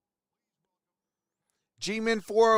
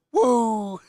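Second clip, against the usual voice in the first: failed attempt at a wrestler's woo; half-successful catchphrase woo, voice giving out; Ric Flair; 3 semitones higher, 4 dB louder than usual. Shown as a demonstration. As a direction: try a big woo with a worn-out voice. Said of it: "try to woo like Ric Flair".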